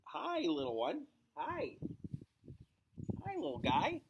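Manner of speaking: high, cooing little greeting. Real, not acted. The reader breaking off to greet a small animal, cooing. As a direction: coo softly at a tiny pet.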